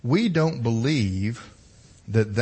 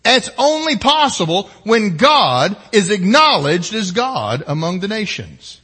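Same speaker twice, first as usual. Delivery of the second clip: forceful preaching; 8 semitones higher, 9 dB louder than usual. Acted.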